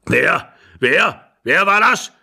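alarmed, escalating shouts; old man calling out. A narrator's reading. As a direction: demand it from across the room. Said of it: "rief der Alte von der Tür her".

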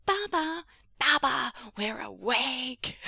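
put-on high, loud excited kid's call; drawn-out, high-pitched announcement; child calling out. A narrator's reading. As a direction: holler for Dad like a small child. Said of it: "familiar voice of Arling called out".

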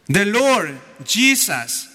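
ordinary talk, nothing marked

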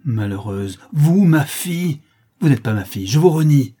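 high, loud cries of disowning; mother disowning her daughter in a rage; furious outburst. A narrator's reading. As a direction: hurl it at the guilty daughter. Countess disowns her arsonist daughter.

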